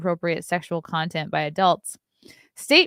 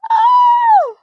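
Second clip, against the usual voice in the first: startled shout; 14 dB louder than usual. Real, not acted. Sudden startled outburst on stream.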